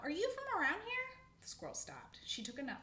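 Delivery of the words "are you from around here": high, loud called question; calling out. A narrator's reading. Old squirrel asks a passing stranger, calling out. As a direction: call across to a stranger. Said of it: "he called out".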